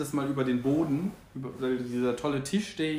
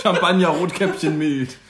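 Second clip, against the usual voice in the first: laughing; 4 semitones higher, 7 dB louder than usual. Real, not acted. Joking through laughter about wedding drinks.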